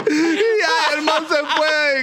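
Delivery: very high words through laughter; helpless laughter. Real, not acted. Storyteller wrapping up his tale, real high laughing voice. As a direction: try to finish while still cracking up.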